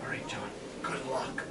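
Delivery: breathy whispered good luck. Retold or imitated. Sister wishing her brother luck, whispered. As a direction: whisper so the frog won't hear.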